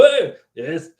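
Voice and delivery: high-pitched mock Austrian gym bully; hammed-up bodybuilder ordering people around; Arnold Schwarzenegger. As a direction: goad a weakling in an imitated Austrian accent. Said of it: "you know, poking people".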